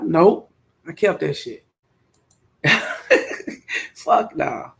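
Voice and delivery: ordinary delivery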